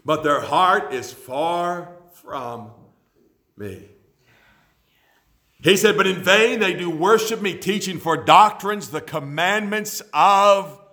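loud preached scripture reading; preaching scripture. Acted. Preacher reading Jesus' rebuke to the congregation, preaching at full force. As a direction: thunder the verses at the congregation.

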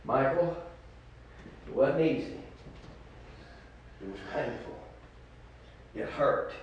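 low, hushed preaching with long pauses; quiet, heartfelt pulpit confession; solemn testimony. Real, not acted. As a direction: confide it softly, letting each phrase settle.

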